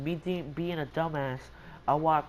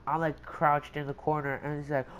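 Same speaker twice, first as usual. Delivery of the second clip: ordinary delivery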